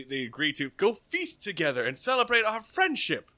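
ordinary talk, nothing marked

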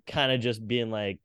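ordinary talk

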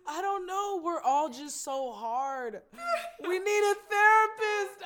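high tearful complaint, rising to a wail; almost breaking down. Real, not acted. Lamenting how hard the family is, tearful wail. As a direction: lament it through tears, half joking. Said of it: "I almost broke down".

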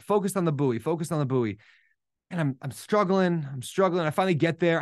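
ordinary delivery

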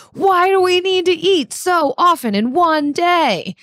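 high, dramatic exasperated question; exasperated home cook. Acted. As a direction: wail it like a tired cook. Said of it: "maybe questioning".

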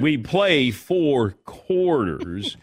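put-on slow, drawn-out Southern accent; Jerry Jones impression. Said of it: "my Jerry Jones one".

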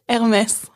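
"Hermès" without the h, French r. Shown as a demonstration. Teaching a friend the brand name, French pronunciation. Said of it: "I have to do it the French way?".

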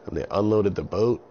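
ordinary delivery, nothing marked

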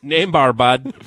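hammed-up hockey accent; Letterkenny hockey talk. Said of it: "The letter Kenny talk".

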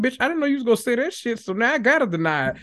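mock flustered denial. Gossip friend caught lying, fast mock flustered denial. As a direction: play someone caught out, scrambling. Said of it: "you're like".

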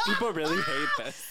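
squeaky laugh through the words; giggling high-pitched. Real, not acted.

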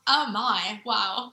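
loud mock-English echo in Yorkshire style; attempted Yorkshire accent. American guest echoes host's surprise, attempted Yorkshire accent. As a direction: parrot him in a faked Yorkshire accent. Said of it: "replicate how I speak".

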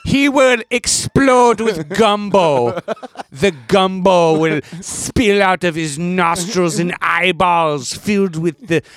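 slow, hammed-up villain proclamation; mock villain. Podcaster playing a soup torturer in a joke fantasy, mock villain. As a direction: relish each word like a cartoon torturer.

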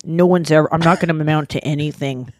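low, dejected mumbled self-pity; mock despondent murderer; self-pitying Dahmer. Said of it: "he's just like, "I'm a failure".

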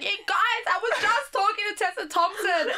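high, giddy re-enacted announcement; giddy excitement. Guest retells running to her friends with news, giddy excitement. Reporting star encounter to friends, retold.

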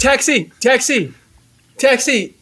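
high-pitched hailing call. Acted. Passenger hailing a taxi in role play, calling out.

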